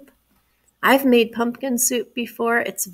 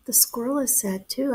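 usual speaking voice

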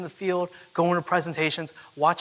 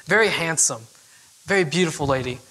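ordinary talk

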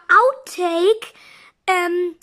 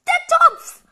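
alarmed cry; 10 semitones higher, 4 dB louder than usual. Acted. Warning about the pot.

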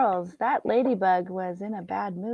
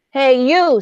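grouchy shout; 7 semitones higher, 12 dB louder than usual. Acted. Grouchy ladybug picks a fight.

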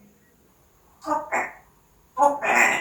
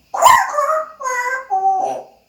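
high sing-song mimic; 10 semitones higher, 3 dB louder, slower than usual. Shown as a demonstration. Mimicking a sound for the bird.

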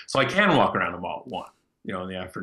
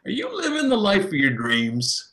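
high, slow, over-eager mock salesman voice; cheesy sales pitch; 10 semitones higher, 3 dB louder than usual. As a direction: ham up the fake-friendly recruiter line. Mocking a mall recruiter's pitch.

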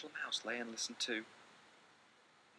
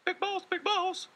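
much higher, louder, slow little voice; calling little mouse; 11 dB louder than usual. Acted. Little Mouse calling his brother at night, high squeaky call. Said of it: "called Little Mouse".

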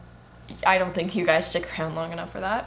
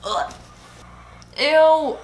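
disgusted; 7 semitones higher, 6 dB louder than usual. Real, not acted. Reacting to gross scene.

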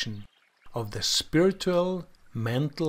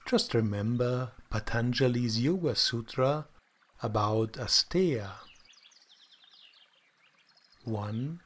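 calm, soft guided meditation voice; soft spa whisper; 3 semitones lower, 4 dB quieter than usual. Acted. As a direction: guide them gently into stillness.

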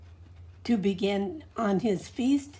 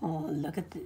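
whispered aside; 4 semitones lower, 12 dB quieter, faster than usual. Real, not acted. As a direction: hush and point it out. Aside to the child.

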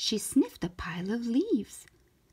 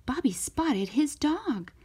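ordinary delivery, nothing marked

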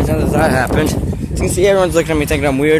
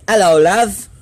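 higher, louder British greeting with rising end; British accent; 5 semitones higher, 5 dB louder than usual. Shown as a demonstration. Vlogger showing off accents to viewers, British accent. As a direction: put on a British accent for a hello. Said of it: "Here's my British".

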